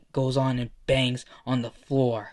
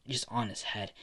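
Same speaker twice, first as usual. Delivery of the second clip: usual speaking voice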